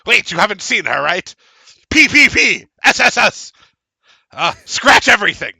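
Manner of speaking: very high, loud squeaky rant; creature reading a rant. Acted. Creature reading the host's angry postscripts, very high and loud.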